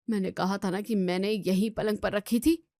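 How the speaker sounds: normal talking voice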